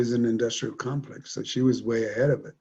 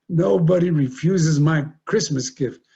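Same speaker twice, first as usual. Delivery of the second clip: furious; 5 semitones higher, 6 dB louder, slower than usual. Retold or imitated. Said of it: "banging his head on the floor".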